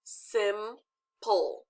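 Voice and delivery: Simple broken into "sim" and "ple"; Simple with a long pause between syllables; split into syllables. Shown as a demonstration. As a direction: say Simple as two separate beats, sim, ple. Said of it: "Test it".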